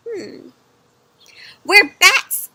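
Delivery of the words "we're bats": louder, higher mother bat voice. Acted. Mother bat tells her baby what bats can do. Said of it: "said Mother Bat".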